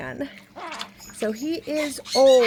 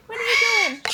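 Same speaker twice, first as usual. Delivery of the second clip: much higher, sing-song pet voice; 9 semitones higher, 3 dB louder, much faster than usual. Real, not acted. Owner cooing at her parrot, high pet voice. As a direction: coo the question up high.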